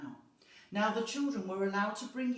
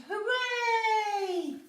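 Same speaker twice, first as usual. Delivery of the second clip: long, high, loud hooray; shouted cheer; 10 dB louder than usual. A narrator's reading. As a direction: cheer like a crowd of freed children. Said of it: "The children shouted".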